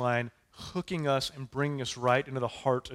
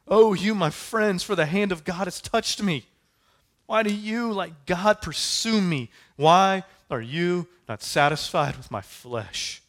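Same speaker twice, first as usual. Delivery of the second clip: anguished pleading; 5 semitones higher, 6 dB louder than usual. Acted. Job pleading with his friends, louder and higher. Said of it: "if you wanted to scream at something".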